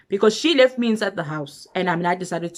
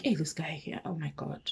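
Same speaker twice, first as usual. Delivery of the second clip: muttered dismay; 2 semitones lower, 10 dB quieter than usual. Retold or imitated. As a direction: mutter it under your breath in dismay. Muttered reaction to the drunk boyfriend.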